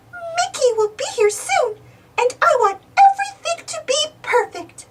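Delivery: high, sweet voice of Minnie Mouse; high-pitched cartoon mouse voice; Minnie's voice. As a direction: lift the voice up high and bright for Minnie. Minnie's voice in the story.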